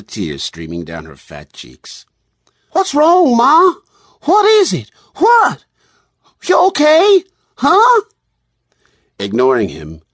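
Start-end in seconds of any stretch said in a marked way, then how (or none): 2.7-8.0 very high, loud character voice, anxious questions; worried Noah. A narrator's reading. Noah asking Ma why she's crying, worried and high-pitched. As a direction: pester Ma in a piping, worried voice. Noah alarmed at Ma's tears.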